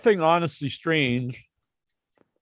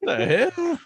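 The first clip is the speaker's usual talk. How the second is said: surprised; 13 semitones higher, 4 dB quieter than usual. Real, not acted. Startled reaction.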